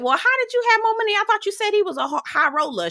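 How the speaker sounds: high, rushed mock disbelief; retold incredulous challenge. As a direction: mimic the table's quick, high-pitched challenge. Table grilling Mia, retold.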